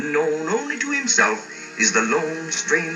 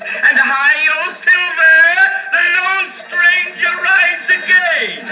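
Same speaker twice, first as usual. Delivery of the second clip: booming, slow, much higher heroic announcement; grand announcer shout; 14 semitones higher, 6 dB louder, slower than usual. Acted. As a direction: proclaim it slowly like a big radio opener. Dramatic radio-style show opening.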